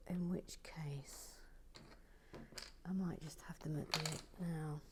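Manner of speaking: quiet mutter under breath; muttering to herself. Real, not acted. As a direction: mutter the words to yourself. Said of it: "I'm just muttering to myself today".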